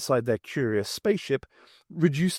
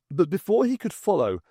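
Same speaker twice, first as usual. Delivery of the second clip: usual speaking voice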